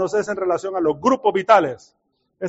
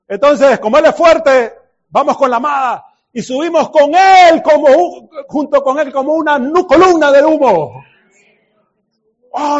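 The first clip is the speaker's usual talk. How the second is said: louder joyful preacher's cry; rousing preaching; 4 semitones higher, 7 dB louder than usual. Real, not acted. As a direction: lift the room up. Preaching at full force.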